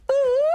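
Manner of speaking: high falsetto "ooh" sound. Retold or imitated. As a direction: squeal a high "ooh" to get attention. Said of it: "He was like".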